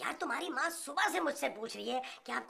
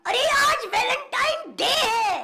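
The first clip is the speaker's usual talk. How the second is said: excited shout; 9 semitones higher, 10 dB louder, slower than usual. Acted. Realizes it's Valentine's Day.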